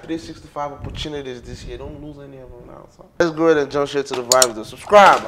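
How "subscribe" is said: a loud, punchy call to subscribe; loud plug. Shown as a demonstration. Telling viewers to subscribe in a loud punchy call. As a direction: bark the word Subscribe. at the audience. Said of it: "I didn't forget that part".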